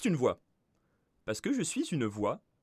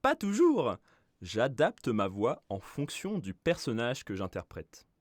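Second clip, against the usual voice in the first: ordinary talk, nothing marked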